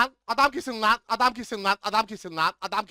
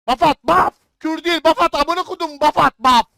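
yelling much higher and louder at teammates; furious shout; 6 semitones higher, 11 dB louder than usual. Real, not acted. Gamer screaming at his teammates to throw a buff, furious shout. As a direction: bark the order, fully enraged.

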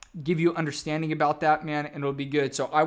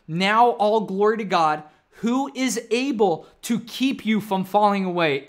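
scripture read slowly and louder; slow, weighty reading; 4 dB louder than usual. Shown as a demonstration. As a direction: lay each word of the verse down slowly. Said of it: "I'm gonna read it again".

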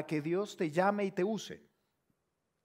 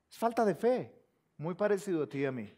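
normal talking voice

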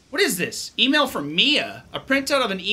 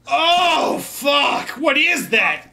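startled disgust; 7 semitones higher, 4 dB louder, much slower than usual. Real, not acted. Spots cockroach.

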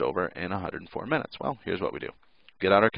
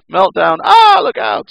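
loud, high cartoon scream; hammed-up alarm, shouted; mock panic; 13 dB louder, slower than usual. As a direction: shriek a warning as the reactor blows. Acts panic at reactor meltdown.

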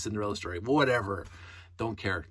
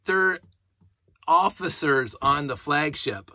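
higher, louder, slow outraged "officers"; indignant retort; 4 semitones higher, 6 dB louder, slower than usual. Retold or imitated. Fan scolding the film's writers, slow and outraged. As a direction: spell it out in disbelief. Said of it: "I'm going".

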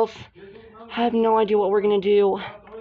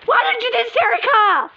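pretend accusing cry; 10 semitones higher, 3 dB louder than usual. Doll quarrel, accusing.

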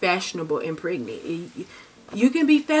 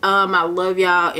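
normal talking voice

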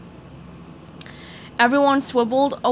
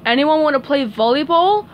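calling out; 4 semitones higher, 6 dB louder than usual. Acted. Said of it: "called Larry above the noise".

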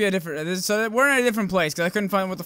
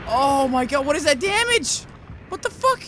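very high, alarmed outburst; shocked disbelief; 13 semitones higher, 5 dB louder than usual. Real, not acted. Player stunned by goblins' damage, shocked disbelief. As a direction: exclaim in rising incredulity.

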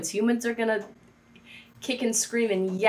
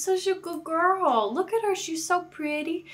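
put-on doggy baby talk; 6 semitones higher, 5 dB quieter than usual. Cooing at the dog again.

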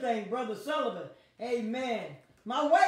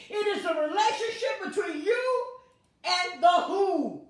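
loud, high-pitched preaching calls; call and response preaching; 7 semitones higher, 6 dB louder than usual. Real, not acted. Preacher leading the online congregation in call and response. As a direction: shout the who, answer it back.

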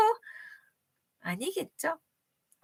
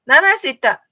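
confident pupil cry; 9 dB louder, faster than usual. Pretend. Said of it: "손".

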